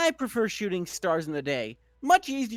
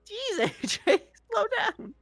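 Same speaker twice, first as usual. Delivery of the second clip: whiny call; 12 semitones higher than usual. Acted. Calling after Applejack who cantered off.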